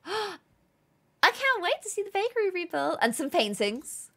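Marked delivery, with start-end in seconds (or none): 0.1-3.0 eager high put-on voice; game character.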